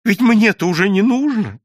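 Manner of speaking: high, plaintive bitterness; voiced character's bitter resignation; bitter complaint. A narrator's reading. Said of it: "с горечью произнёс голос регистратора".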